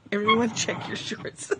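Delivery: ordinary talk, nothing marked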